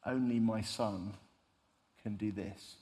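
slow, understated fatherly pride; quiet pride. Retold or imitated. God the Father nodding at his Son, quietly proud. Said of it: "says quite proudly".

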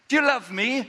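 high, loud pressing question; Jesus asking. Acted. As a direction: press him, voice lifted.

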